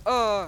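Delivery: high, very loud mock cry; pretend yell of pain. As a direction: cry out as if struck. Figure hit in play fight.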